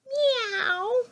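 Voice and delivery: high, drawn-out imitated meow; cat meow. Reader answering what a cat says, high imitated meow. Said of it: "What does a cat say?".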